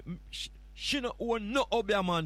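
usual speaking voice

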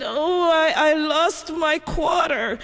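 put-on high, teary little girl's wail; a small girl's crying complaint. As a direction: sob it out like a child who lost her coin. Crying girl voiced in story.

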